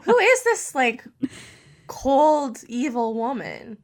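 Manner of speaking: shocked indignation; incredulous outrage. Real, not acted. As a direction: sound appalled on her behalf. Outraged reaction to the story.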